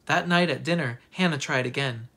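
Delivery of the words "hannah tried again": ordinary delivery, nothing marked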